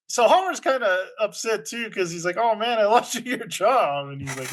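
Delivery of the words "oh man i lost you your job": high-pitched retold apology; lightly hammed-up regret, pitched up; mock dismay. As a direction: act out an upset friend apologizing. Homer upset over friend's job.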